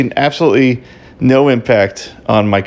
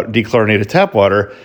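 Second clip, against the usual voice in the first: normal talking voice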